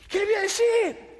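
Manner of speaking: high-pitched mock panic; panicked question. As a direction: act out someone at a total loss. Acting out Dani's panic. (pánico imitado, voz aguda)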